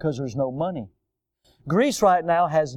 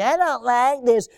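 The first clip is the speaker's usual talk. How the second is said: put-on whiny kid; 7 semitones higher than usual. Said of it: "one of the kids would come up and say".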